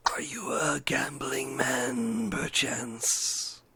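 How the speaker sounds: slow eerie whisper of a riddler; whispering sphinx. Acted. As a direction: breathe the riddle out slowly, menacing. DM voices masked skeleton.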